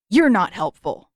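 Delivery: curt, irritated retort; snapping. Acted. Zuko snapping at the captive girl, irritated. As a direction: bite the words off at her. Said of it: "Zuko snapped".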